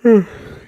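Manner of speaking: big loud groaning yawn. Real, not acted. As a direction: let out a long noisy yawn, high then falling. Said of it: "Sorry for the yawning".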